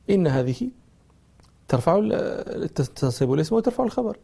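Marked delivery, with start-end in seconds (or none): none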